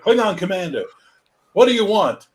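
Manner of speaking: high, loud heroic captain; Kirk impression. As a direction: bark like a starship captain. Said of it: "he's like".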